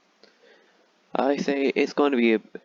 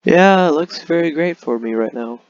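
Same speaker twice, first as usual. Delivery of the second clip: higher, louder sarcastic outburst; mock cheer over a disaster; loud sarcasm; 7 semitones higher, 9 dB louder than usual. Real, not acted. Gamer reacting to spreading rebels, loud sarcasm.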